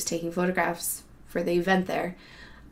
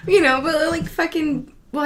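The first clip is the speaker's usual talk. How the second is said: usual speaking voice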